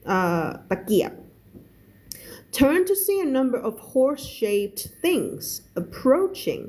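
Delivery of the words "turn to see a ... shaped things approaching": slow English reading, pitched higher; story read-aloud. Shown as a demonstration. Teacher reading a novel to learners, slow higher narration. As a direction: read it slowly and higher, word by word.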